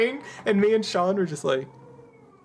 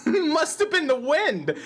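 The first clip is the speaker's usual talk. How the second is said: Skyrim quote; 9 semitones higher, slower than usual. Retold or imitated. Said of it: "That's a Skyrim reference".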